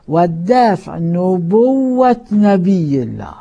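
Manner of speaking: loud, slow preaching of the hadith; hadith recitation. Acted. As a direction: declaim the warning to the congregation. Repeating the hadith forcefully.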